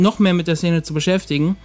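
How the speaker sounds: usual speaking voice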